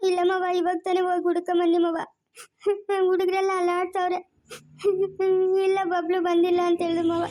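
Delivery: sobbing between phrases; tearful report, breaking off to sob; crying. Acted. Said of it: "ಅಳ್ಬೇಡ ಸುಮ್ನಿರಮ್ಮ". (ಬಿಕ್ಕಿ ಬಿಕ್ಕಿ ಅಳುತ್ತಾ)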